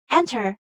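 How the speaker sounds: "enter" with EN strong, ter weak; first-syllable stress. Shown as a demonstration. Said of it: "it is stressed on the first syllable".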